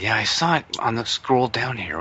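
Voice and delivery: ordinary talk, nothing marked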